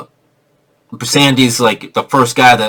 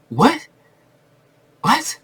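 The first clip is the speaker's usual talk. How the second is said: baffled; 11 semitones higher, 6 dB quieter than usual. Retold or imitated. Baffled reaction.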